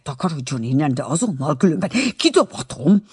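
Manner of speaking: hissed threat rising in pitch and force; angry hiss. A narrator's reading. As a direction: order someone out through a hiss. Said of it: "és így sziszegett".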